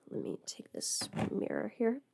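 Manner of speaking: soft unvoiced whisper to herself. Real, not acted. Doing makeup, whispering to herself. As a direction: breathe the words under her breath.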